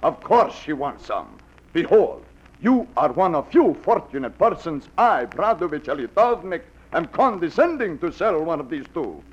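fake Russian accent. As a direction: play a fake Russian peddler at the door. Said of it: "like the accent, the beard".

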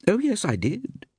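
high-pitched, airy agreement; flippant. A narrator's reading. Actress admitting she praised the play, flippant. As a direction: toss off the admission flippantly. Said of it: "agreed Iris flippantly".

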